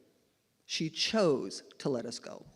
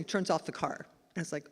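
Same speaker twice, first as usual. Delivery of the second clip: ordinary talk, nothing marked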